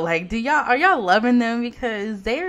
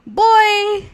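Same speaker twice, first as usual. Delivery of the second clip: high, loud burst of disbelief; loud exclamation; 10 semitones higher, 8 dB louder than usual. Real, not acted. Startled reaction to the game.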